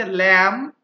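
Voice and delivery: lamb said loud and clear as lam; silent b. Shown as a demonstration. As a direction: lamb with the final b left silent, stated firmly. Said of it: "বি উচ্চারিত হচ্ছে না".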